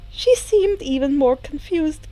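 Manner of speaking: ordinary delivery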